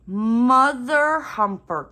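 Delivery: loud, slow minced curse at her own mistake; real frustrated outburst. Real, not acted. Annoyed at mixing up her drills, a loud slow curse.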